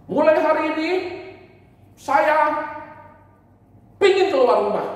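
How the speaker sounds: put-on high, loud childish declaration; defiant child. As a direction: announce it like a stubborn kid. (anak kecil yang membangkang, suara tinggi)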